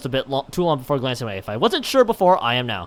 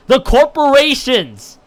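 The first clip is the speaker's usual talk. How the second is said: emphatic exclamation; 8 semitones higher, 8 dB louder, much slower than usual. Acted. Character exclaims answer.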